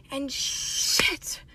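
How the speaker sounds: whispered oh no. Real, not acted. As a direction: breathe it out. Realizing the mistake.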